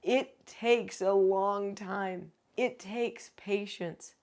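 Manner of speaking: slow, drawn-out, patient stress. Real, not acted. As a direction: stretch it out to show the patience. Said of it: "You keep going".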